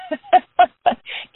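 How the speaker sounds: a quick high laugh at her own joke; high giggle. Real, not acted. Joke about dressing waist up.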